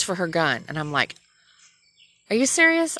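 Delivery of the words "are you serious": high, loud disbelief; incredulous. Retold or imitated. Driver to cop reaching for her gun, incredulous. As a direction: act out shocked disbelief at the officer.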